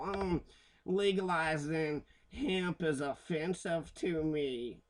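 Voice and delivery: slow, prissy put-on voice of an offended prude; mocking prude. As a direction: drag it out like a pearl-clutching prude. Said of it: "judgmental fucking asshole".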